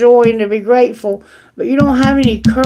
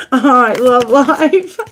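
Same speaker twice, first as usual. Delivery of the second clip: laughing; slower than usual. Real, not acted. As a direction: say it slowly through leftover laughter. Still laughing after the joke.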